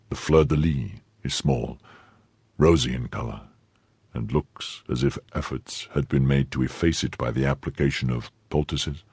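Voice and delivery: low, soft, measured recall; subdued questioning. Acted. Stricken musketeer checks her description, subdued. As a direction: list her features quietly and heavily.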